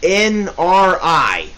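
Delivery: slow, letter-by-letter spelling; measured spelling with gaps between letters. Shown as a demonstration. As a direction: spell it out slowly, each letter separate. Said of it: "It's spelled H E".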